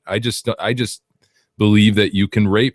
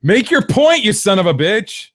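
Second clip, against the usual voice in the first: mock angry; 11 semitones higher, 3 dB louder than usual.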